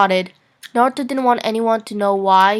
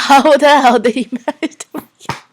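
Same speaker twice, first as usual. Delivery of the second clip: higher, louder, laughing through it; retry laughing; 4 semitones higher, 6 dB louder than usual. Real, not acted. Reader retrying the line while laughing. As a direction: push through the giggles. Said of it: "Say the sentence over".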